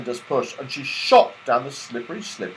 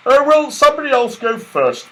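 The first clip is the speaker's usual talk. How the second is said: high, louder eager voice; excited Santa; 12 semitones higher, 9 dB louder than usual. A narrator's reading. Santa hesitating at the slip, eager and excited. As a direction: bubble with giddy nerves.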